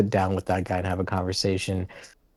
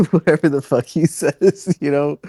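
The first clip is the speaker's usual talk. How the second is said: higher, laughing dismissal; 7 semitones higher, 4 dB louder than usual. Real, not acted. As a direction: laugh through the words as you brush it off. Laughs while dismissing his quote.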